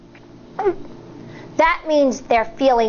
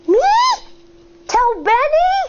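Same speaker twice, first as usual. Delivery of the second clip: put-on a much higher startled child, rising at the end; a nervous kid can't believe he must speak up; surprised; 11 semitones higher than usual. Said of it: "Schubert's eyebrows rise up".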